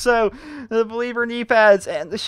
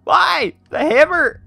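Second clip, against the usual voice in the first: whiny lament; 8 semitones higher, 9 dB louder, much slower than usual. Real, not acted. Another wrong drop, no STG.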